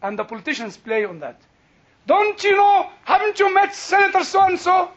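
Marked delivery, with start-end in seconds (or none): 2.1-4.9 high, loud indignant questioning; indignant self-importance. Retold or imitated. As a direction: act the offended big shot, voice raised. Acts out self-important big shot.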